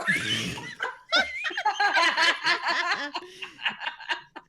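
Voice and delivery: long, high laughter. Real, not acted. Laughs at friend's quip.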